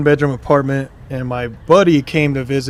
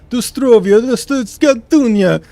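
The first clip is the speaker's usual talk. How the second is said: louder, higher mock Russian accent, stretched slow on the second; 8 semitones higher, 6 dB louder, slower than usual. Stranger overheard through the door, mock Russian accent. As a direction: drag out fake Russian gibberish. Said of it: "I just hear, like".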